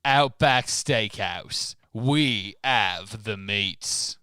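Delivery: slow, loud ad slogan; ad announcer. Pretend. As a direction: boom it like a TV commercial. Mock ad slogan voice.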